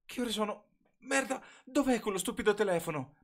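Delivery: high, loud, frantic searching; panicked. A narrator's reading. Bella, late and half asleep, panics looking for her phone. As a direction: rush it as if you've lost your phone. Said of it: "nel panico".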